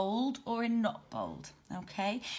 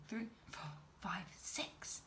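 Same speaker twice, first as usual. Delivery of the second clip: whispered count; 13 dB quieter than usual. Shown as a demonstration. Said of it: "How many have we got?".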